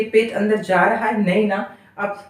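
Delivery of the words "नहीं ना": ordinary talk, nothing marked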